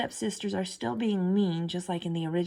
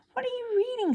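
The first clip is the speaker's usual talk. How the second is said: much higher curious question; curious Cinderella; faster than usual. A narrator's reading. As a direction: ask it in a high, eager girl's voice. Cinderella asks stepfamily.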